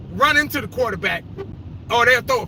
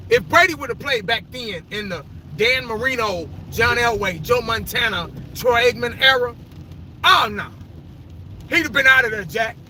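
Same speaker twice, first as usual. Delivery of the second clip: normal talking voice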